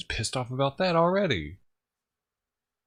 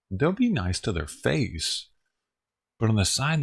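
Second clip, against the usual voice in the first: normal talking voice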